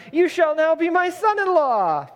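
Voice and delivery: high, ringing royal announcement; grand proclamation. Acted. Saul declaring to David a second time, grandly proclaiming. Saul's proclamation acted in scripture reading.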